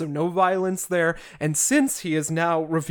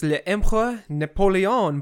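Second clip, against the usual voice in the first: French pronunciation; 2 semitones higher than usual. Shown as a demonstration. Quoting French phrase from scripture.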